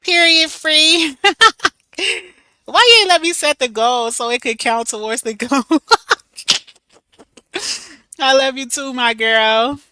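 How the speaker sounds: loud, high, giggling delight; excited and laughing. Real, not acted. Laughing loudly at Free's gift, thrilled and high. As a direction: squeal it with joy at her friend. Reacting to friend's gift in chat.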